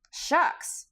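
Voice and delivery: dismissive indignant scoff. A narrator's reading. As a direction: brush it off indignantly. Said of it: "returned Tip indignantly".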